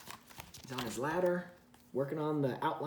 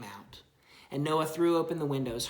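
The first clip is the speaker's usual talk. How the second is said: storybook narration for a little one; reading to a child; 5 dB louder than usual. Acted. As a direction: read it gently to your child. Parent reads Bible story aloud.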